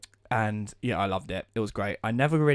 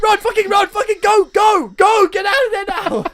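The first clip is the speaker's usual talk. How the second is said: high full-volume acted panic; frantic screaming; 11 dB louder than usual. Friends scream at Alex to flee the minotaur, frantic screaming. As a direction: yell it over and over, desperate to escape.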